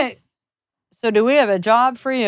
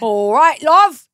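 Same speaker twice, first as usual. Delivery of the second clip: hammed-up Cockney greeting; mock Cockney; 3 semitones higher, 4 dB louder, much slower than usual. Teasing the co-host in a mock Cockney accent. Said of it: "It's such a bad accent when you got the fucking Cogny".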